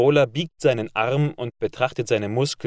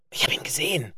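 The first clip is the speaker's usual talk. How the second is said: higher, unvoiced breathless whisper; 5 semitones higher, faster than usual. A narrator's reading. Kriegs clutching Jerome's arm, whispering breathlessly. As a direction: whisper it with gleeful excitement. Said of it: "flüstert er atemlos".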